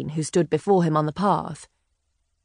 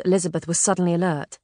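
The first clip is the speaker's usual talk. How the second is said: plain reading voice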